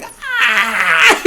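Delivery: very high, loud joyful gasp; delighted gasp. Acted. Liam and [name] gasping at the giant ring, high delighted shriek. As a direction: gasp in wonder like a cartoon kid. Zoom on Liam's face.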